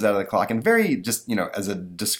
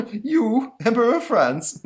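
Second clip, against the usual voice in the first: very high, loud scoffing monster voice; mocking monster; 5 dB louder, slower than usual. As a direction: scoff in a shrieking cartoon monster voice. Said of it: "says the monster".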